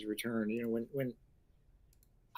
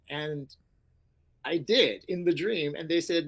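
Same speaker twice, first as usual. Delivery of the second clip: ordinary delivery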